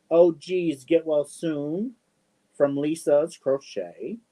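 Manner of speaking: usual speaking voice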